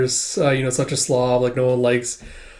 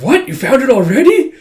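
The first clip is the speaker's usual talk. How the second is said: high, astonished disbelief; high, louder shocked question; surprised; 10 semitones higher, 7 dB louder than usual. Retold or imitated. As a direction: gasp out the question, stunned. Surprised someone found it fast.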